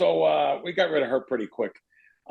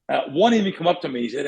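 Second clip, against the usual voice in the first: ordinary talk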